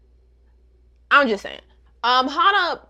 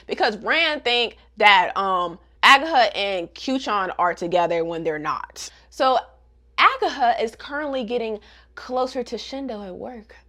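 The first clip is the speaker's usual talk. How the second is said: ordinary talk, nothing marked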